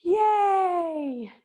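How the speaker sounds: high, drawn-out mock "yay"; mock group cheer. Instructor imitates the class hearing about ab work, mock cheer. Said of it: "And everybody went".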